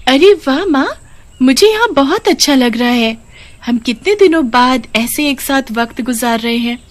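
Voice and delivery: a happy daughter out walking with her mother; little girl. Acted. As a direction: chirp it like an excited young girl. Narrator voices the little daughter.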